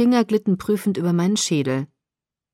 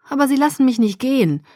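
plaintive complaint, pitched higher; klagend; 6 semitones higher, faster than usual. A narrator's reading. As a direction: complain about being held back, voice lifted. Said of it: "klagte ich". (klagend, mit angehobener Stimme)

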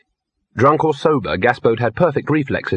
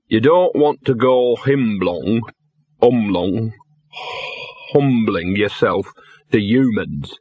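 slow, drawn-out delivery of a lecture; lecturing drunk dog; slower than usual. A narrator's reading. Drunk dog lectures his companion on true dogness, preachy. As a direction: hold forth slowly as a drunk dog.

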